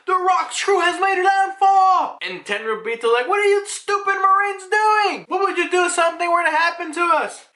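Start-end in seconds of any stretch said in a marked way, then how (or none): 0.1-2.2 high shouted warning call; shouted alarm. Retold or imitated. Marine soldier shouting the alarm across the island, high shout. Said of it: "they're, like, yelling out". 3.3-7.5 whiny noble. Retold or imitated. Voicing panicked Celestial Dragon.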